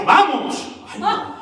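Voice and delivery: high, loud mock yell of "¡Vamos!"; imitated sudden yell. Churchgoer interrupts the preacher mid-sermon, imitated sudden yell. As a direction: burst out with an imitated congregant's shout. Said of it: "pegaba el grito".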